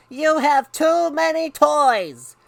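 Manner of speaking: slow, high exasperated shout; loud scolding from the stairs; yell. Acted. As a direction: yell it drawn out, like a fed-up mom. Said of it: "she yelled on her way upstairs".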